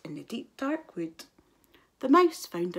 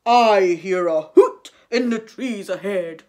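a big hungry beast; Gruffalo voice; 11 dB louder than usual. A narrator's reading. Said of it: "till the Gruffalo said".

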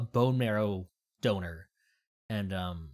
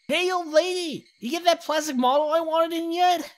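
put-on high, loud squeaky kid yelling; cheeky kid; 14 semitones higher, 6 dB louder than usual. Kid demanding his model from the old shopkeeper, cheeky. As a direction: pitch way up like a brash little boy.

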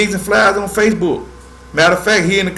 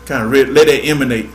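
ordinary talk, nothing marked

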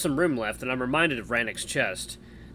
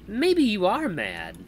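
slow, higher-pitched teasing jab; mocking; 9 semitones higher, 4 dB louder, much slower than usual. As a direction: draw it out in a mocking woman's voice. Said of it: "rolls her eyes and laughs mockingly".

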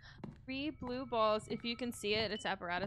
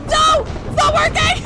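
very high, loud frantic scream; panicked scream; 21 dB louder than usual. Real, not acted. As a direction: shriek in real panic. Panic when device fails.

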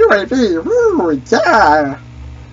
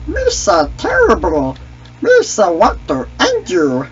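Misa voice; 6 semitones higher than usual. Acted. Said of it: "I can do a pretty good impression".

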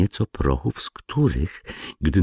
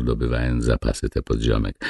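ordinary delivery, nothing marked

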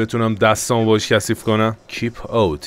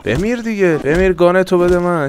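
mock kill shout; 7 semitones higher than usual. Shooting NPC, demanding gun.

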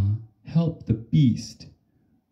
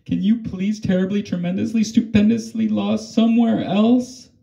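put-on much higher and fast, a prim girl shooing the beast; Alma's voice; 9 semitones higher, faster than usual. Alma telling the beast to get lost, high and fast. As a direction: rattle it off high and quick, wanting it gone.

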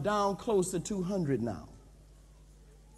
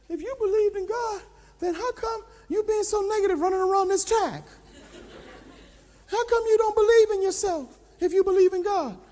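high, loud pushing trainer voice; trainer's scolding; 11 semitones higher, 4 dB louder than usual. Retold or imitated. Trainer goading pastor around the track, high pushing voice. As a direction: goad him on, high and hard.

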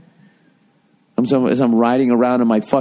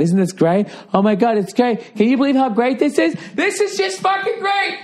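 gushing delight climbing to a strained high scream; mock-ecstatic heaven dweller, ever higher in pitch; manic mock cheer; 13 semitones higher than usual. Mimics relentlessly cheerful people in heaven.

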